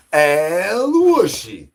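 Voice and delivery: high drawn-out call. Acted.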